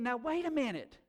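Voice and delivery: indignant retold thought; exasperated disbelief. Retold frustration at bank.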